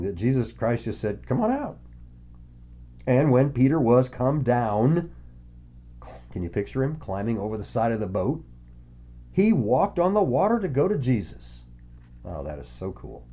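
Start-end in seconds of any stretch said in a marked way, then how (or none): 1.3-1.8 inviting call. Retold or imitated. Jesus invites Peter. 12.2-13.3 much softer, lower murmur of wonder; hushed awe. Real, not acted. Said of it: "awe at walking on water".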